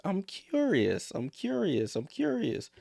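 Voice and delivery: teasing impression of a curious reporter; mocking the reporter. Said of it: "She always starts her questions".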